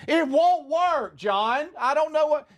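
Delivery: high, stretched, panicky mom voice; exasperated mom. Retold or imitated. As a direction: wail it at John, about to give up. Mom calling back, exasperated.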